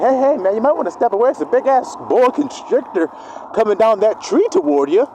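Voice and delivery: mock urgent warning. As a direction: raise the alarm a notch. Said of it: "I'm like".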